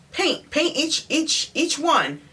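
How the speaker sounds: high, drawn-out, word by word; retold exasperated coaching, pitch jumping up; exasperated instruction. Spells out instruction to painter.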